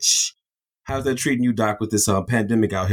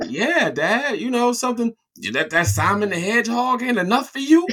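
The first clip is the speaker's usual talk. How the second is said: high mocking dad voice; mock the kid like a teasing father; imitating dad; 12 semitones higher than usual. Dad teasing his son about wanting games, high mock voice.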